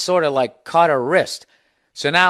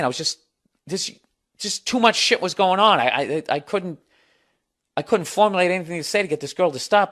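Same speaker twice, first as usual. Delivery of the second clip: ordinary delivery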